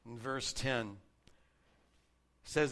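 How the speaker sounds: ordinary talk, nothing marked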